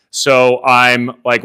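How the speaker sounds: ordinary talk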